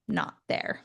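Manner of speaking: whispered under the breath; hushed. Retold or imitated. Telling of her missing name, whispered. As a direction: let it drop to a near whisper.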